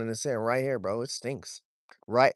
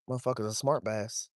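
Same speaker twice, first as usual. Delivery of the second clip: ordinary delivery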